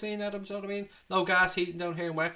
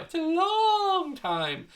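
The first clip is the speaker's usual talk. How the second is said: high, long-stretched taunting cry; gloating taunt; 14 semitones higher, much slower than usual. Pretend. Rubbing in the trophy drought.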